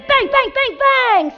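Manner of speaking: high, loud punchy bangs; bang sound effects. Acted. Fairy acting out the wand blasts for children, high loud bangs. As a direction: fire off each bang like a spark.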